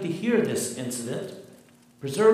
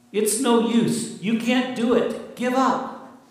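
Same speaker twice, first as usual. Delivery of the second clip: higher, louder, slow taunting tempter; voice of evil; 8 semitones higher, 5 dB louder than usual. Acted. Preacher voicing Satan tempting the listener, taunting. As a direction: sneer it out as the devil's discouraging voice. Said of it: "evil and Satan whisper in your ear".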